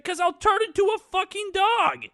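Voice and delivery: very high, loud acted outburst; exasperated yell. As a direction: yell it back in mock frustration. Prince's exasperated retort, acted out.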